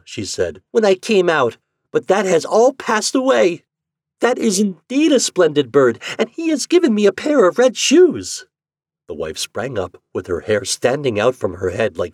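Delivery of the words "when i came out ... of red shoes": put-on high, loud, happy girl; high-pitched storybook delight; delighted Marlene. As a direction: exclaim joyfully in a raised child's voice. Marlene happy with new shoes.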